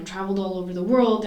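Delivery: normal talking voice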